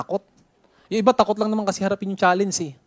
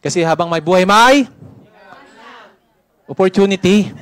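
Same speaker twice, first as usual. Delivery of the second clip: loud emphatic; 3 semitones higher, 7 dB louder than usual. Acted. Repeats it louder, nobody answered.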